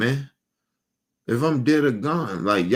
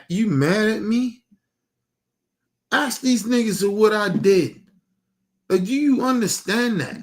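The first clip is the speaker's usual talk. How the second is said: indignant disbelief; 5 semitones higher than usual. Real, not acted. Indignant rant at absent relatives.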